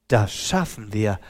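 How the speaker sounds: low, soft and steady; calm reassurance. A narrator's reading. The helper calmly reassures the despairing woman. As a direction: reassure her quietly. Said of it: "sagte der andere".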